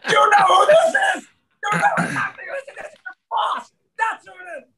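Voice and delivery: high, laughing mock yell of the boss; mock screaming boss. Boss screaming at him over the phone, laughing mock yell. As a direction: shriek it like the furious boss on the phone. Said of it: "it's like".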